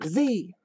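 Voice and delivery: "Z." said plainly as zee, short and flat; just drop "Z." as zee, done; "Z." as the one-syllable American zee. Shown as a demonstration. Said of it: "We just say this".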